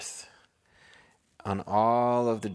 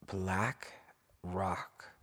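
plain reading voice

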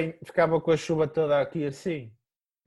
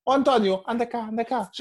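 excited; 7 semitones higher than usual. Retold or imitated. Dentist calling colleagues over.

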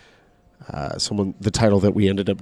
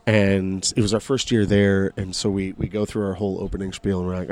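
ordinary delivery

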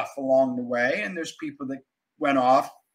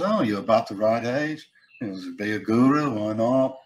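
lower-pitched impression of the musician; 6 semitones lower than usual. Musician friend coaxing the speaker, low imitated voice. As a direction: casually coax in a low put-on voice. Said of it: "[name] said to me".

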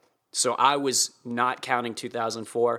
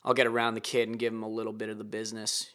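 ordinary delivery

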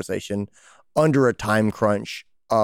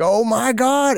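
high, louder mock dismay; dismayed gasp; 11 semitones higher, 4 dB louder, slower than usual. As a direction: act out the manager's shock. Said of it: "the practice manager is like".

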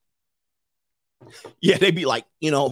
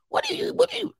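mock nosy wife; 5 dB quieter than usual. Mock wives' question.

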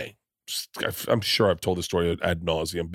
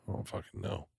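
muttered reply; 2 semitones lower, 16 dB quieter than usual. Retold or imitated. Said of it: "And I go".

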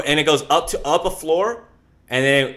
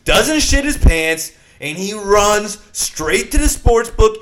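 louder and higher, drawn out, climbing to a shout; building triumphant boast; 4 semitones higher, 7 dB louder than usual. Acted. Telling his co-host the Vegas story ending: rising, triumphant boast. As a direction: brag like a hero, swell to the finish.

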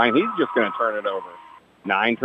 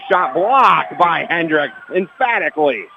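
high, drawn-out call of a big block; real excited sportscaster call; excited play-by-play; 6 semitones higher than usual. Real, not acted. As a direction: call the blocked shot with punch, pitched up and slowed. Live call of a blocked shot.

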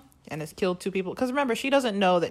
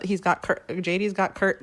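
usual speaking voice

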